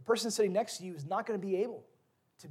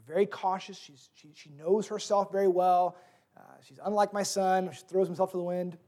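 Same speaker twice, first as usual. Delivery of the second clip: ordinary talk, nothing marked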